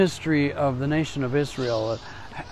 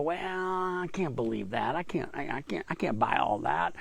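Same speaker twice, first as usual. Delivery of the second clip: softer, mumbled skeptical grumble; doubting churchgoer; 6 dB quieter than usual. Retold or imitated. People doubting God's promises, softly skeptical. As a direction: shrug it off as too much to swallow. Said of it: "many of us sit around and go".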